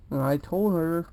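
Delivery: normal talking voice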